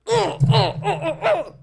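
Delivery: high, repeated mock groans of disgust. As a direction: groan it out like a whiny kid. Disgust at group work.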